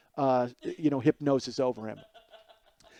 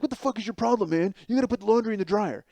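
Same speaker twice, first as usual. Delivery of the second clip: raised angry yell, higher; older brother yelling at Glenn over laundry, raised yell; 8 semitones higher, 3 dB louder than usual. Retold or imitated. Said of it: "and I'm yelling at him".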